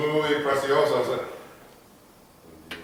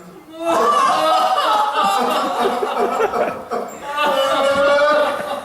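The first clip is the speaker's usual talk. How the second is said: long, loud, high burst of laughter; big laugh; 13 semitones higher, 7 dB louder than usual. Acted. After his own joke about the ex-wife, big laugh. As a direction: cackle at your own punchline.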